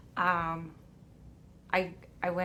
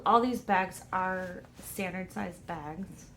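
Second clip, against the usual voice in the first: ordinary delivery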